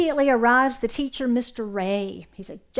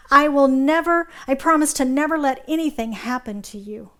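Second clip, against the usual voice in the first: earnest vow; 3 semitones higher, 7 dB louder than usual. Acted. Dad promising baby Nemo.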